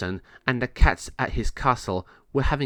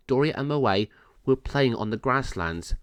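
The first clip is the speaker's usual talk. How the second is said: ordinary delivery, nothing marked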